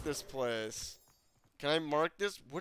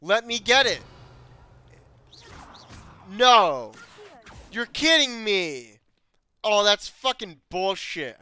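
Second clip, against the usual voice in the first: loud, higher frustrated yelling; angry outburst; 5 semitones higher, 11 dB louder than usual. Real, not acted. Gamer raging at a chest he can't open, angry shouting. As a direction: rage at the locked chest. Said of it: "I'm angry now".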